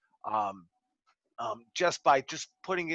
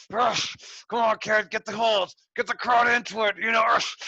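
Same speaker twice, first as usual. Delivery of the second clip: calling spots in the ring; 6 semitones higher, 3 dB louder than usual. Retold or imitated. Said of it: "he's calling everything like this".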